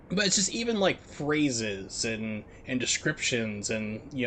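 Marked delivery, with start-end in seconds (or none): none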